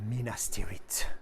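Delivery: nom chuchoté lentement, presque religieusement; révèle-le dans un souffle, sans voix; chuchotement. Real, not acted. Révélation de la pièce tant voulue. (chuchotement lent et grave)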